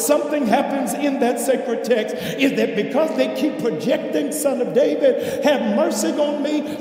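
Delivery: insistent preacher, voice lifted; impassioned preaching. Real, not acted. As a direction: press the point home to the congregation. Preaches at full force.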